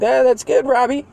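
high, breezy mock mom voice; a distracted mother brushing it off; mom's approval. Mom absentmindedly praising her son Robbie, high mock voice. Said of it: "And she'd be like".

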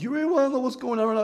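high and fast, half exasperated; high excited challenge. Retold or imitated. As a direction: fire it off high and quick. Challenging question.

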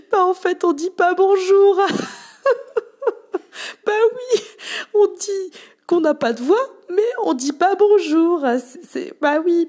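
high, loud laughing mockery; mocking laugh. Shop assistant laughing at the voiceless customer's logic, high and loud through laughter. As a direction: laugh at the absurd excuse while you mimic it.

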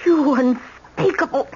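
high, slow, choked with fury; can't finish the insult; outraged. Acted. Grandmother outraged at the seducer Dana.

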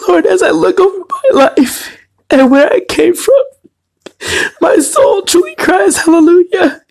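real weeping prayer, voice breaking high; crying prayer. Real, not acted. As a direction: pray through the tears, voice cracking. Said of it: "forgive the crying".

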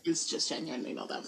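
soft under-the-breath deadpan; muttered aside. Real, not acted. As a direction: drop it to an aside. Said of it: "deadpan aside".

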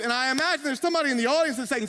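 ordinary delivery

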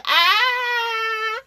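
high, loud party squeal; excited squeal. Real, not acted. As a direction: let out a sudden happy shriek.

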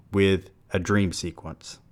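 usual speaking voice

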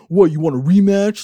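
loud, high imitation of a challenge; mock fighting challenge. Boxer ready to fight his rival, loud mock challenge. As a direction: act out someone spoiling for a fight. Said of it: "ready to fight".

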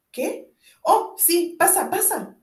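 high, startled invitation to come in; a sudden loud, high burst of flustered politeness. A narrator's reading. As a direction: caught off guard, hurriedly recover. Paralyzed man flustered into inviting her in.

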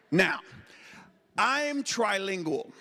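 ordinary delivery

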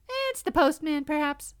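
high hopeful mouse voice; tiny squeaky critter. Acted.

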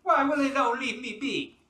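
put-on loud, high Jamaican accent. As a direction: wail it in Jamaican patois. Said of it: "that's it".

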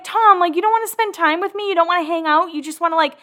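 imitating her younger self whining at her brother; whiny complaint. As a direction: complain to your brother, hurt. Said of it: "I was kinda like".

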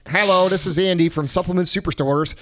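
ordinary talk

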